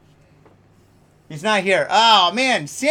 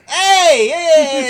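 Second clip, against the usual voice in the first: loud, high, exaggerated Quebecois call; hammed-up Quebec French, brash and punchy; Quebec French accent; 9 semitones higher, 10 dB louder than usual. Host mocking how Quebecers talk, loud Quebec French accent. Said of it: "they speak like this".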